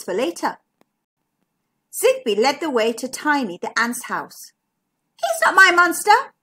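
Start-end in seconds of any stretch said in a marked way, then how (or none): 5.2-6.3 put-on high, loud childlike protest; crying out. A narrator's reading. Zara rejects the ant as her monster, crying out. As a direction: call it out high and indignant. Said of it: "cried Zara".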